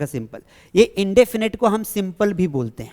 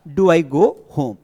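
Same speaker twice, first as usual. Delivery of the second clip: slow dictation; 3 semitones lower, much slower than usual. Shown as a demonstration. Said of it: "तो यहां पे sentence बनेगा".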